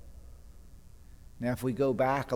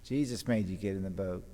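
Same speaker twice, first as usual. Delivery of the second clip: normal talking voice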